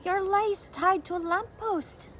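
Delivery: high, rising joyful cry; father overjoyed at finding the lace. A narrator's reading. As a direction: call out the hope you found. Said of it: "their father cried".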